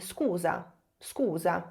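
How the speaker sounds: scusa said quietly in Italian, falling; a soft casual "scusa" after bumping someone; quick apology to a stranger at the station. Shown as a demonstration. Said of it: "você pode dizer".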